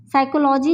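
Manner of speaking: साइकोलॉजी starting on s, no p; silent p in psychology. Shown as a demonstration. Teacher modelling psychology with silent p. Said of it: "यहाँ भी पी हमारे पास silent है".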